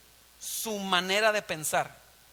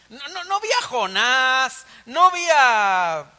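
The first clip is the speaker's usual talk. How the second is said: loud, slow, drawn-out denials; emphatic boast; 4 semitones higher, 7 dB louder, slower than usual. Acted. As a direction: raise the pitch and stretch each denial. Acting out Peter's emphatic boast. (jactancia enfática, lenta y alargada)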